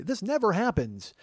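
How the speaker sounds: high, loud, drawn-out exasperation; hammed-up exasperated protest; mock outburst. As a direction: blurt it out slowly in disbelief. Retort he holds back from saying.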